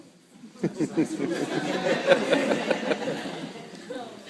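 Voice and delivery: a loud burst of real laughter, fading off. Real, not acted. Recalling summer school, loud real laugh. Laughing at his own story.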